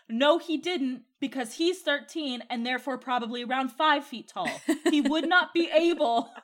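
laughing through the rant; laughing disbelief. Retold or imitated. Reader laughing at the book's scale errors. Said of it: "I'm like".

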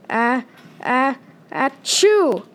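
slow, building "ah, ah, achoo"; drawn-out sneeze. Acted. The cat Ralphie sneezing at the mouse, slow and drawn out. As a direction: stretch the sneeze out then let it burst.

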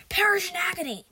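loud, high vengeful shout; wrathful goddess yell. A narrator's reading. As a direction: hurl a curse at fleeing enemies. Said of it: "Sekhmet yelled".

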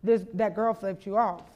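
insistent hushed. Retold or imitated. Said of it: "She's like".

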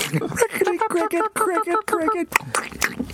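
very high chirpy cricket chirps; cricket noise. Pretend. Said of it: "make a crackling sound".

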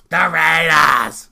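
slow, drawn-out announcer call; announcer shout. Acted. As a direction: stretch it like a ring announcer.

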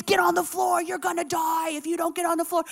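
high, urgent mock command; panicked order. Mother telling her kids to take cover, panicked. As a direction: imitate a frantic mother.

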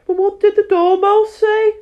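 high, loud curious juror. Acted. Juror voiced in story.

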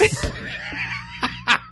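squealing laugh; high burst of laughter. Real, not acted. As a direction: crack up high. Laughing after impression.

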